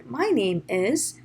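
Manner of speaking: slow, musical "My name is", pitch swooping; exaggerated tune on "My name is", left hanging; intonation demo. Shown as a demonstration. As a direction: let "My name is" flow up and down like music. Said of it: "And intonation".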